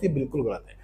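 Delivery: ordinary talk, nothing marked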